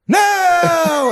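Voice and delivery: long, very high mock shriek; panicked scream. Caught man running from the sting, panicked. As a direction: wail it out in cartoon terror.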